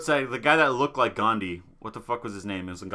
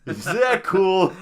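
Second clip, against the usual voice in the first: ordinary talk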